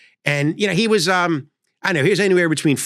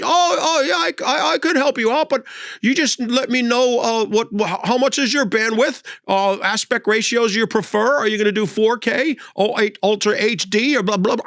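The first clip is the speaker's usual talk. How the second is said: put-on rambling old man; 8 semitones higher than usual. Elderly installer rattling jargon.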